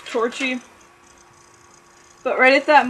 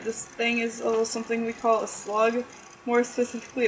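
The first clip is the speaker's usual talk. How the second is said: usual speaking voice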